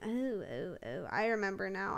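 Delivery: ordinary delivery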